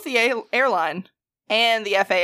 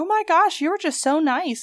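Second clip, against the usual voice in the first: high-pitched mock gushing; gushing newcomers; 8 semitones higher than usual. New York couple thanking a Southern worker, mock gushing. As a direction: imitate gushing customers, pitched way up. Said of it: "a couple from New York that had just recently moved here".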